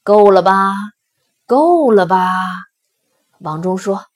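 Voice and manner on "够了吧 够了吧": loud, impatient question; raised voice, losing patience. A narrator's reading. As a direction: press it hard, fed up. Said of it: "石狮子不耐烦地问".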